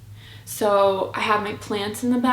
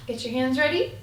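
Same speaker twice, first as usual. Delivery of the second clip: normal talking voice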